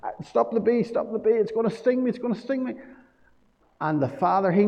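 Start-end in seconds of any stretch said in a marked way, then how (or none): 0.0-2.8 put-on scared child. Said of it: "She was really scared".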